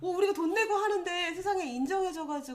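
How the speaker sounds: high, loud amazed gushing; retold elation. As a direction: re-enact being thrilled at unexpected good news. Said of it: "정말로 팔짝팔짝 뛰었던".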